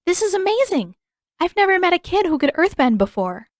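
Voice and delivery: high, loud gushing delight; thrilled. Acted. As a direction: gush with excitement at a stranger. Katara excitedly greeting the boy.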